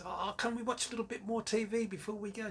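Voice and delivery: high-pitched mock begging; pleading child. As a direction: ask big sister like a hopeful kid. Retold child begging for more TV.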